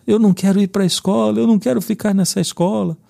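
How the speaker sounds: voz aguda de criança, um leve chorinho; choro imitado. Retold or imitated. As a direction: imite o filho resistindo à escola, choramingando. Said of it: "E ele começou a chorar". (menino recusando a escola, voz aguda e manhosa)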